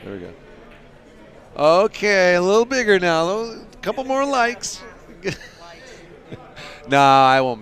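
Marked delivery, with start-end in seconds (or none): 1.6-4.8 high, loud playful wheedling; teasing coaxing. Pretend. Streamer dangling a bigger picture for likes, teasing. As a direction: climb higher as you tease for more.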